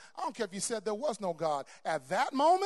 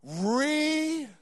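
loud, high, drawn out; booming command; commanding call; 9 semitones higher, 7 dB louder than usual. Shown as a demonstration. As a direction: call it out for the crowd to echo. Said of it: "repeat after me".

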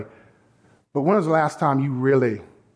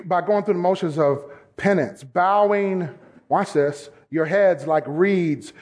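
ordinary delivery

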